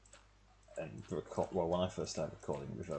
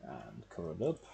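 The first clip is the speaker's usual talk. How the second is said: ordinary talk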